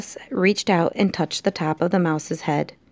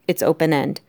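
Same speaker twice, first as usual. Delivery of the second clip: usual speaking voice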